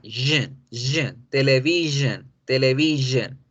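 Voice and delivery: JEN and TELEVISION with buzzing zh; sh con Z. Shown as a demonstration. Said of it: "pero con Z". (JEN y TELEVISION con sh sonora, vibrada)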